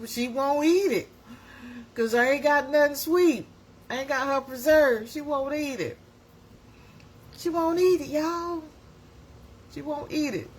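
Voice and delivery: high-pitched retold complaint; mock exasperated plea. Caregiver explaining the fussy baby to the uncle, mock exasperation. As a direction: plead helplessly, hammed up.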